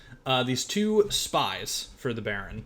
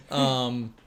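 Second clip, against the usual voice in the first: ordinary talk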